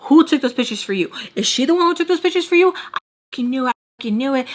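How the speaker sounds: high-pitched imitation of a jealous ex; mock accusing. Her ex grilling her about the pictures, high mock accusation.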